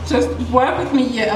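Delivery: normal talking voice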